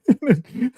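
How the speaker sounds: high burst of laughter; real laugh. Real, not acted. Podcaster cracks up recalling father and son exchange, laughing. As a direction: crack up at the joke.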